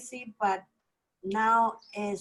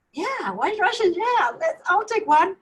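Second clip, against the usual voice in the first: high, louder imitation of an eager friend; eager acceptance; 8 semitones higher, 4 dB louder than usual. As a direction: jump at the offer, pitched right up. Friend accepts drink offer eagerly.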